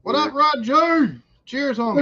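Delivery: high, loud, slow greeting; hearty shout-out. Real, not acted. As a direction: call out to a viewer in chat. Greeting a chat viewer.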